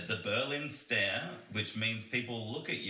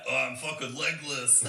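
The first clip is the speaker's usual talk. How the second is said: put-on drunk joke; 3 semitones higher, 4 dB louder than usual. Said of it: "I'd always make the joke when I'm drunk".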